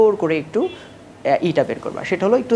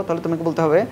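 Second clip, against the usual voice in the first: ordinary talk, nothing marked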